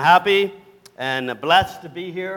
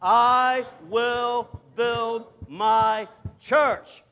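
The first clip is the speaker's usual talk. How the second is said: very slow, every word held long and higher; slow unison declaration; 4 semitones higher, much slower than usual. Shown as a demonstration. Preacher leading congregation one more time, slow emphatic declaration. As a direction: spell it out word by word, landing on "church". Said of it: "Let's say it one more time together".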